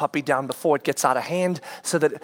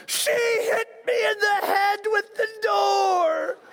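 put-on slow, much higher whiny crying kid; imitated crying; much slower than usual. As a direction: wail it like a wounded boy. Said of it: "you're crying like something's bleeding".

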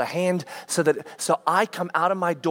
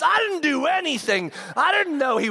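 put-on higher protesting girl; defensive daughter; 9 semitones higher than usual. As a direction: insist it was an accident.

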